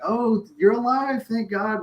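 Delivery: high, hammed-up relieved gasp; mock relief. Film producers relieved he survived.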